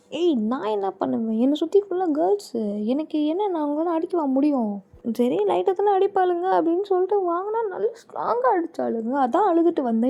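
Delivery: high-pitched protesting; indignant, whiny excuse; aggrieved self-defence. Acted. Defends himself over being beaten.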